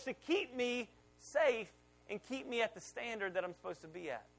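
ordinary talk

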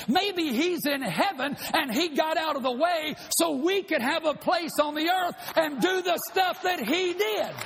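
rising into a shouted sermon; preaching at full force. Real, not acted. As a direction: preach it up to the rafters.

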